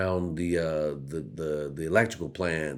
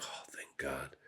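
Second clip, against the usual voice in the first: whispered relief; 3 semitones lower, 14 dB quieter than usual. Retold or imitated. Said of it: "I was like".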